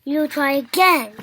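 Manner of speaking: ordinary delivery, nothing marked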